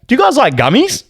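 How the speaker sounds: high, swooping imitation of an uncle; mock-casual uncle, voice lifted high; uncle's offer. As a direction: pitch it up and swing into the question. Retelling uncle's eager offer.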